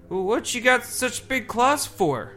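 put-on high, slow, stammering boy; frightened boy. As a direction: ask it trembling like a child. Boy questions the creature.

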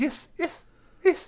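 high, stammering nervous greeting; timid satyr voice. Acted. To the tavern crowd, a high, stammering shy hello. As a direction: squeak out a shy hello at the bar.

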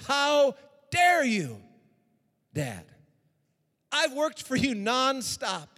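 loud, slow, high outraged cry; angry son. Acted. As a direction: hurl it at the father slowly. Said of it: "he gets angry".

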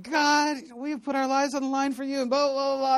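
high-pitched mock yell at God; retold yelling. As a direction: rant upward in a strained mock yell, no real volume. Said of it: "I just started yelling at the Lord".

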